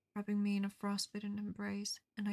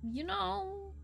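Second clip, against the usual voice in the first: much higher, playful sing-song; high silly voice; 10 semitones higher, much slower than usual. Real, not acted. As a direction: tease in a squeaky voice.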